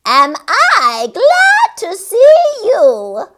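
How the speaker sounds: high, loud, slow cackle voice; cackling creature voice. A narrator's reading. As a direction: gloat like a little witch. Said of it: "she cackled".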